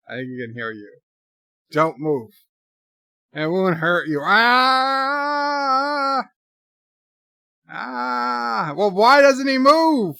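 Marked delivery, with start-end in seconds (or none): 4.2-6.2 high, loud startled scream sound; mock scream. Streamer screaming with the film's scare, startled mock scream. 7.7-8.7 mock scream. Screaming along with the movie. 8.7-10.2 high, loud exasperated yell; mock yell. Streamer quoting the film's panicked line, loud mock yell. Yelling the movie line along.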